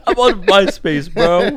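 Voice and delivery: loud, high, drawn-out mock outrage; hammed-up slow, loud complaint; mock indignant protest. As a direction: jokingly protest at being interrupted. Listener mimics the busy worker, laughing.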